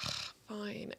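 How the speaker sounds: very quiet, sighed reluctant concession; acted-out reluctant sigh of agreement; grudging. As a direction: give in under your breath, put out. Grudging response to a crying girl.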